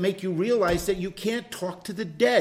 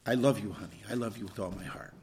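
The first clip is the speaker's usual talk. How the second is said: heartfelt love line; 9 semitones lower, 7 dB quieter than usual. Retold or imitated. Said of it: "Tell her like you mean it".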